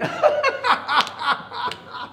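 high, loud belly-laugh cackle; rabbit laughing. A narrator's reading. Rabbit falling over at the caterpillar, high loud laughing. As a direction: burst into high helpless laughter. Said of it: "he fell over backwards laughing".

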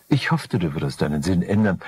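plain reading voice

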